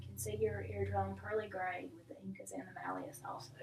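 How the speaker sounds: whispered note to self; whispering. Real, not acted. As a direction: murmur the findings under your breath. Quiet aside noting exam findings.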